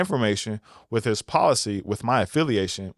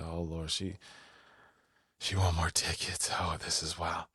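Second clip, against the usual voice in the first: muttered aside; 6 semitones lower, 11 dB quieter than usual. Retold or imitated. His inner reaction, muttered.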